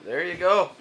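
laughing. Real, not acted. Laughing at the cat.

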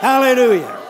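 loud, jubilant praise shout; shouted hallelujah. Shown as a demonstration. Preacher showing the congregation how to rejoice after the amen, shouting. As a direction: shout it out to show eager anticipation. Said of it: "you start shouting right after the amen".

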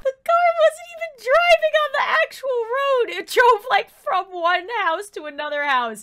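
loud, high mock horror at the car; hammed-up panic. Wife watching husband hit by car, hammed-up panicked yell.

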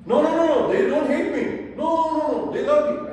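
put-on high, loud insistent kid voice; a child loudly protesting no no no. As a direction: whine it like a teen denying everything.

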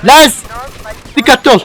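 anxious calling. Real, not acted. Gamer anxiously calls his buddy Lens to climb on. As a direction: plead with him to hurry. Calling partner during escape.

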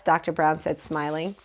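ordinary delivery, nothing marked

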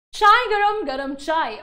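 tea seller calling out, voice lifted at the start; light imitation of a hawker's cry; high-pitched vendor call. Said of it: "a vendor called out in a high-pitched voice".

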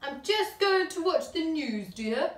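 a dad home from work; tired dad. A narrator's reading. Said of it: "said Mister Large".

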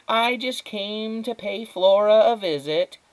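slow, high, sugary politeness; stretched-out social sweetness; fake adult smile. A narrator's reading. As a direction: sound falsely pleasant to the other adult. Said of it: "fake adult smile back".

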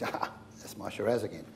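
normal talking voice

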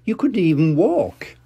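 a hint of chuckling mother; Mummy Pig. Mummy Pig laughing at Peppa's idea. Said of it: "laughed Mummy Pig".

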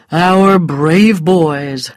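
put-on scornful, dragged-out brave boys; sneering. A narrator's reading. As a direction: make praise sound like an insult. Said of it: "made it sound like an insult".

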